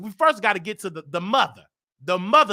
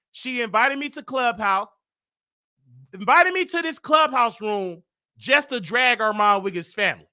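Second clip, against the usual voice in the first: usual speaking voice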